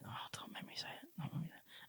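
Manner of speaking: barely audible, breath-only reluctant mumble; reluctant whisper. Retold or imitated. Husband avoiding telling his wife the truth, reluctant whisper.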